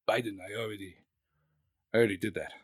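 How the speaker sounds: low, soft, halting reply; a quiet, low put-on Russian leader; Putin impression. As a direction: answer wearily, low and quiet. Acting Putin answering Biden.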